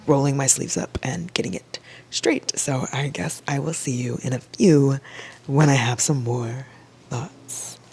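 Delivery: soft whispered aside; whispering to the viewers. Real, not acted. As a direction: hush it like a secret. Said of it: "whispered sign-off to camera".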